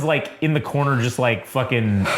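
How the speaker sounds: ordinary delivery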